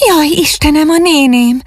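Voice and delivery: high-pitched cry of alarm; frightened exclamation. A narrator's reading. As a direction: exclaim in sudden fright, voice shooting up. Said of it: "kiáltott fel ijedten". (ijedt, magas hangú felkiáltás)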